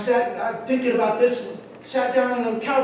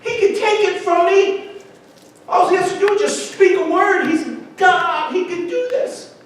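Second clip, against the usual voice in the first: loud, high, exasperated outburst; retold angry cry of a man losing patience; frustrated shouting at God; 7 semitones higher, 4 dB louder than usual. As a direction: shout it up at heaven, fed up. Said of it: "I'm getting so frustrated".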